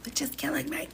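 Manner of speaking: real stifled laughter, hushed and high-pitched; soft, breath-only chuckling with a high, whispery squeak; quiet chuckles. Real, not acted. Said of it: "I gotta do my quiet chuckles".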